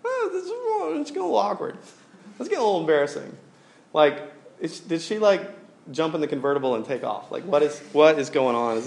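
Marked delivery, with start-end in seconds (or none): 0.0-3.3 high, uneasy thinking aloud; mock nervous fretting as the minutes drag; awkward inner worry. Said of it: "I was like".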